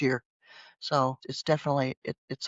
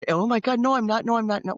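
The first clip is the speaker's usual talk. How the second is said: higher, hammed-up indignant denial; a touch of panicked self-defence; mock defensive protest; 7 semitones higher, 3 dB louder than usual. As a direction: play the flustered, defensive mom. Acted defensive reply to insult.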